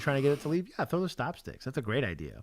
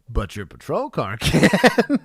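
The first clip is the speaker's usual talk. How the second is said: laughing; 3 semitones higher, 9 dB louder, slower than usual. Real, not acted. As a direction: laugh on the last word. Laughs at the punchline.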